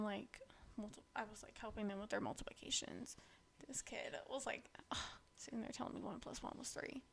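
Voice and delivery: breathy hushed aside; whispering. Real, not acted. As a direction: drop to a whisper.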